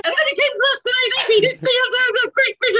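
very high, fast chipmunk voice. Pretend. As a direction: squeak it out like a chipmunk. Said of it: "Do the whole thing in chipmunk voices".

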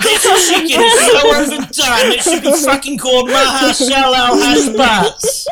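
loud, high, ranting TV chef; hammed-up British chef tirade, high and loud; Gordon Ramsay. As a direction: rail at a ruined dish, half laughing. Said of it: "So Gordon Ramsay, he's just come across".

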